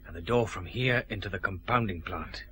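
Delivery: low, softened voice; hushed. Acted. Detective asking the way during a night search, hushed. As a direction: keep it discreetly down. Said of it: "all whispering for".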